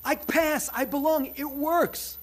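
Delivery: gleeful, proud to belong; elated inner voice. Retold or imitated. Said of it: "I went".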